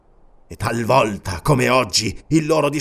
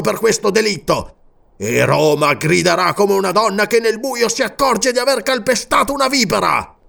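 vengeful oath; 8 semitones higher, 4 dB louder than usual. A narrator's reading. King swearing vengeance on Roman butchers, raging. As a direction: swear bloody revenge.

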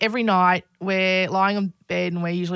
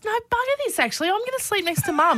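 mock daughter; 11 semitones higher than usual. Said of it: "she goes".

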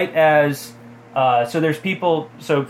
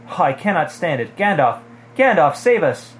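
desperate plea; 5 semitones higher, 5 dB louder than usual. A narrator's reading. Character in terror begs rescue.

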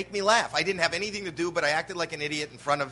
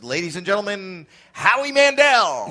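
announcer intro; 3 semitones higher, 5 dB louder than usual. Retold or imitated. Club emcee announcing the new comic to the room.

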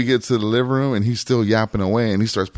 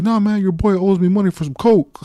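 higher mock voice of a dealer; 7 semitones higher than usual. Dealer complaining to the narrator about money, mock high voice. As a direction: play the cheated dealer, pitched up.

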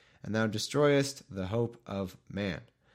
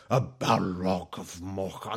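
slow, rough wizard rumble; lightly done gravelly wizard; gravelly throat voice; 2 semitones lower, slower than usual. Shown as a demonstration. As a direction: growl it from the throat like a grand wizard. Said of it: "a low, like, gravelly throat voice is cool".

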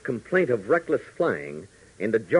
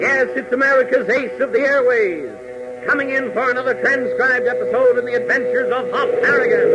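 big, high-pitched announcer intro; radio announcer; 11 semitones higher than usual. Acted. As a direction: announce the show with full showmanship. Show opening announcement.

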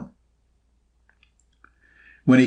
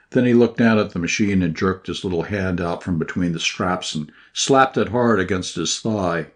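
ordinary delivery

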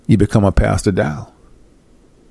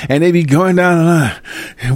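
ordinary talk

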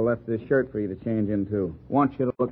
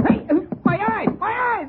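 pained shriek; 4 dB louder than usual. Acted. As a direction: shriek much higher and louder, eyes stinging. Lather thrown in detective's eyes.

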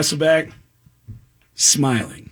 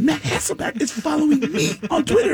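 much higher, breathless and softer; giddy disbelief; 11 semitones higher, 4 dB quieter than usual. Retold or imitated. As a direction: squeal it out in awe. Overjoyed showing friend the follow.

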